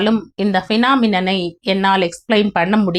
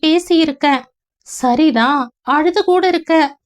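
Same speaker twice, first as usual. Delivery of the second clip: higher, dreamy female voice; young woman Leena voiced; 7 semitones higher than usual. Leena recalling a past life to Ganesh, in a higher woman's voice. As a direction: voice Leena as she drifts back to a half-remembered past.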